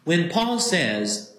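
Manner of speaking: usual speaking voice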